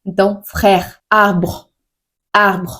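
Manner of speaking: frère and arbre with the R scraped at the back of the throat; guttural French R. Shown as a demonstration. Teacher demonstrating the French throat R. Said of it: "arranhar a garganta".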